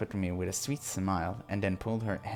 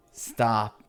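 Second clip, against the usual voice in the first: louder, begging cry; anguished stop; desperate loud plea; 6 dB louder than usual. Acted. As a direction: shout it out to make her stop. Michelle begging under humiliation.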